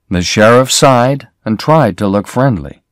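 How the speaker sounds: usual speaking voice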